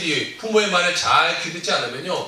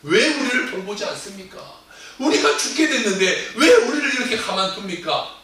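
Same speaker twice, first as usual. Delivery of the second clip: higher, louder whiny complaint with a laugh; complaining; 4 semitones higher, 5 dB louder than usual. Acted. Disciples complaining to the sleeping Jesus, whiny. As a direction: grumble at him half-laughing. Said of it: "불평을 말하고 있어요".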